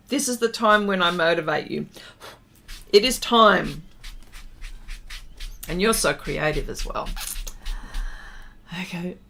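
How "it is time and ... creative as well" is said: ordinary talk, nothing marked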